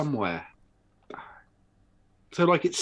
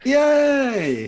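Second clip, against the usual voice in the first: cheer; 12 semitones higher, 6 dB louder than usual. Real, not acted. Greeting viewers on the show.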